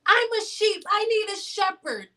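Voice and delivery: high whiny sheep voice; mock sheep. Vlogger mocking her viewers as sheep, high whiny voice. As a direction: bleat it like a helpless follower.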